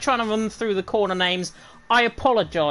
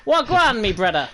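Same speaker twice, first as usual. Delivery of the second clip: Jamaican patois greeting, louder; mock Jamaican accent; 4 dB louder than usual. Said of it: "my Jamaican accent that I just put on".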